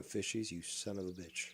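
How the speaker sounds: ordinary talk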